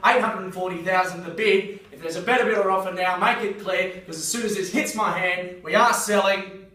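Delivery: loud closing call. Real, not acted. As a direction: drive the room to the final call. Auctioneer closing the sale.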